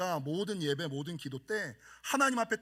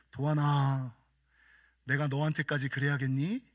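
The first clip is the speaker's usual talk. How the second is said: lower, gently rebuking voice; God's voice; 7 semitones lower than usual. Retold or imitated. God gently rebuking the pastor, lower. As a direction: speak as God, low and sorrowful. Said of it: "성령님께서 뭐라 그러는지".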